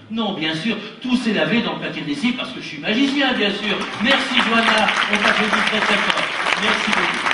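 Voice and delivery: loud, high triumphant magician; triumphant showman. Acted. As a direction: bask in the applause.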